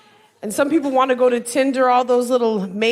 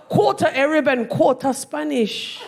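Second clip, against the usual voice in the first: higher-pitched put-on London accent; mock London accent, pitched up; guessed London accent; 4 semitones higher than usual. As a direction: read the list in a broad London accent. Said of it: "guess what his accent is".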